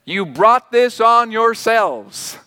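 slow, deliberate rebuke; stern prophet's rebuke. Retold or imitated. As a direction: lay the blame down word by word. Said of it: "he basically says".

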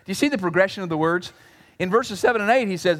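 ordinary delivery

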